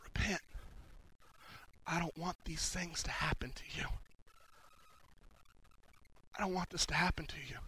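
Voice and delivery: soft, whispered plea from the knees; pleading hush. Retold or imitated. Daniel on his knees begging the king to repent, hushed plea. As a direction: beg him gently, almost under the breath. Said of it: "he pleads".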